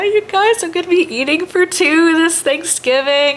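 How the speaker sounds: high, bright-eyed squeal of excitement; excited. Real, not acted. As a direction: gush it out to the viewers, way up high. Excited announcement to viewers.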